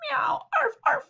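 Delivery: squeaky pet noises; high animal noises; high meow and barks. Acted. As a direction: yap like the pets.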